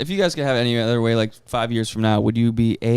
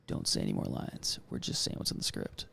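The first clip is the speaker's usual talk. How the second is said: hushed quote; 16 dB quieter than usual. Retold or imitated. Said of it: "She's like".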